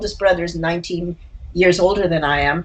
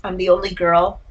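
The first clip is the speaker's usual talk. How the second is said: ordinary delivery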